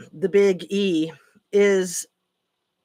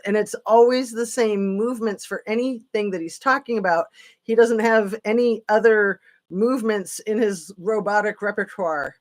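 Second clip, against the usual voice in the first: ordinary talk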